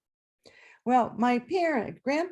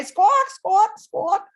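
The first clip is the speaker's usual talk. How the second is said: high loud squawking; chicken squawk; 11 semitones higher, 8 dB louder, much slower than usual. Retold or imitated. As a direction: imitate the hen squawking away on the bus.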